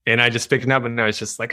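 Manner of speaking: ordinary talk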